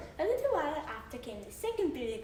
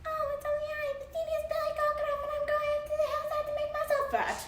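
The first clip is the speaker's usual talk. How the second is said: very high, fast little goat voice; small high goat pleading its way past; tiny goat voice; 3 dB louder, much faster than usual. Acted. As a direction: squeak it out like the tiniest kid goat. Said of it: "who had such a small voice".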